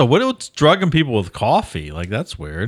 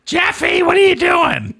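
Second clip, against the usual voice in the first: high, loud granny shout; Dahmer's grandma; 3 dB louder than usual. Pretend. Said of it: "a new character, Dahmer's grandma".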